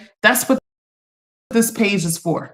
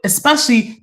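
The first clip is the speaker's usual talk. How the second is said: ordinary talk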